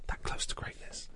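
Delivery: awed whisper of the repeat; whispered, as if afraid to break it. Acted. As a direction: breathe it out in a whisper.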